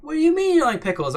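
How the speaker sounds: hammed-up outraged friend, high; mock outrage. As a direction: play the offended friend, hammed-up. Retold offended friend.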